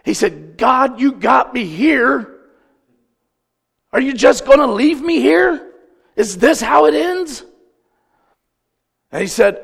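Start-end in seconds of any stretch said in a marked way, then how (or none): 0.6-7.5 loud, high desperate plea; anguished retold cry, drawn out; crying out to God. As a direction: imitate a man at rock bottom yelling up to heaven. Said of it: "I cried out to God".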